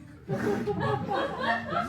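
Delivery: loud quick giggle; giggle burst. Real, not acted. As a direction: snort into laughter. Laughs again reading.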